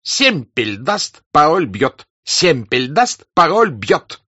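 loud, high, slow angry yelling; angry shout. A narrator's reading. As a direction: bang it out furiously. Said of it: "продолжая кричать".

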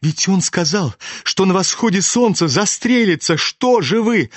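high-pitched, urgent appeal; panicked, pitch climbing to the end; extreme fright. A narrator's reading. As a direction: plead with them in alarm. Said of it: "в чрезвычайном испуге".

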